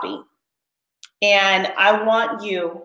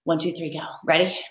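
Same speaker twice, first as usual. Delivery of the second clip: ordinary talk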